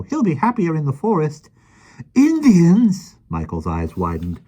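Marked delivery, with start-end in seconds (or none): 0.0-1.5 put-on high, lively voice of a small old man; gentle, chatty little fellow; kindly little man. Mister Mo speaks. 2.1-3.2 high, loud startled question; a boy alarmed at the news; surprised boy. Acted. Michael surprised.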